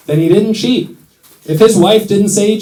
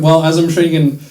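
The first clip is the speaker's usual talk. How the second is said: usual speaking voice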